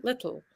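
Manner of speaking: "little" with the t dropped and air puffed out the sides; "little" said with a soft puff of air through the tongue's sides; lateral plosion little. Shown as a demonstration. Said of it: "that's actually a lateral plosion".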